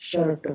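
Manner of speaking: शर्ट with a neutral "uh" vowel; model pronunciation. Shown as a demonstration. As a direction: say शर्ट as "shurt". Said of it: "अ बोलते हैं उसको". (शर्ट में "अ" की आवाज़)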